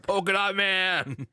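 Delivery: hyped, stretched high shout of the name; excited fans. Retold or imitated. Fan cheering the obscure hero, slow high excited call. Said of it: "and everyone's like".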